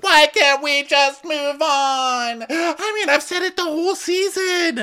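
very high, drawn-out whine; mock desperate wail. Rinna begging the group to move on, mock wailing. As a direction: wail it like a melodramatic plea. Acted quarrel, Rinna pleads.

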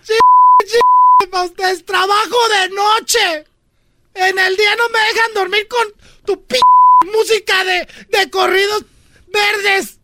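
hammed-up sobbing guilt trip; mock crying mom. Mom fake-sobs at her kids about her sacrifices. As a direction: wail it like a martyred mother.

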